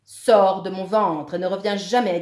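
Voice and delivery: loud furious order; booming, irate monster; angry. A narrator's reading. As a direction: bellow the command without raising the pitch. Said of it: "remonte en colère".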